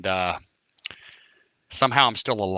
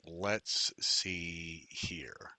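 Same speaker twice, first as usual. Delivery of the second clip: whisper; 6 semitones lower, 10 dB quieter, much slower than usual. Real, not acted. Muttering to himself while searching.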